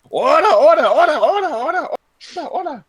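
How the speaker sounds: high repeated yelps, fading at the end; hammed-up high fighting chant, trailing off; mock battle cry. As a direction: yelp it over and over like rapid punches. Acts out pummeling in the fight.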